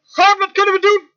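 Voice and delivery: loud, high wail to God; crying out. Acted. Preacher voices man suddenly calling on God, crying out. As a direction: shout up a desperate plea. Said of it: "onmiddellijk begint uit te roepen".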